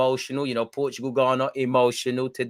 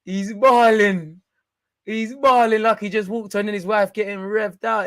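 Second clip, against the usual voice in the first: hyped praise; 8 semitones higher, 5 dB louder than usual. Real, not acted. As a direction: stretch out the praise. Hyping player.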